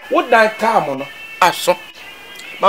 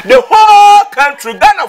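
raised voice; 9 semitones higher, 4 dB louder than usual. Real, not acted. Heated argument about Ghana.

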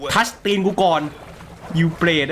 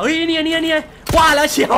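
excited laughing shout; 9 semitones higher than usual. Real, not acted. Snake spotted in water.

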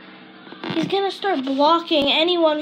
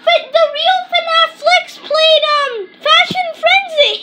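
very high, loud squealed yelling; yelled announcement; 14 semitones higher, 8 dB louder than usual. Real, not acted. Child yelling news to a friend, high and loud. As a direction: shriek the news at full volume.

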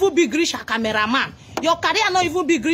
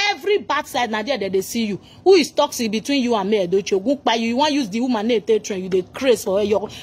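ordinary delivery

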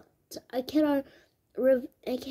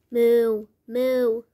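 mock cow moo; 4 semitones lower, 5 dB louder than usual. Animal noise in pet shop.

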